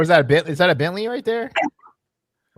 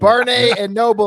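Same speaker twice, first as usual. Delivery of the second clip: loud mock meme delivery; 3 semitones higher, 8 dB louder than usual. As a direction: belt out Barnes and Noble as a joke. Echoing the Instagram meme.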